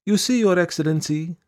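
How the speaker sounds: high-pitched petitioning woman's voice; high, insistent supplicant; petitioner. A narrator's reading. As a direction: plead to an official in a high voice. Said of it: "speaking rapidly".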